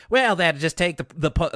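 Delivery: a touch of hammed-up Southern twang; LaDonna's Southern accent. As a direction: imitate a folksy Southern girl's accent. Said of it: "speak in a Southern accent".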